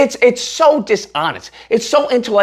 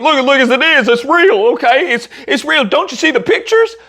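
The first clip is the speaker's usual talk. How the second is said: mildly mocking believer; 6 semitones higher than usual. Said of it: "whether or not you believe".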